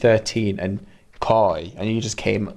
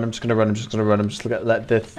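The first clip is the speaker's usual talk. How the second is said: ordinary talk, nothing marked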